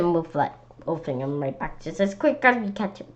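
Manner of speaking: ordinary delivery, nothing marked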